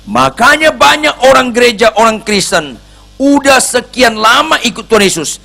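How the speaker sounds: high, loud pulpit preaching; fiery preaching. Acted. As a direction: drive the message home with force. Preaching at full force.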